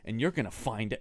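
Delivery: soft, low threatening command; menacing low order. Retold or imitated. Old uncle ordering nephew to funeral home, low quiet menace. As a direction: quietly order him like a dying boss. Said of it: "and he's like".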